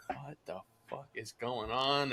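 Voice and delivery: very soft, stunned aside; hushed disbelief. Retold or imitated. Salesperson reacting to client yelling at dog, hushed disbelief.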